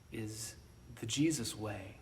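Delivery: ordinary talk, nothing marked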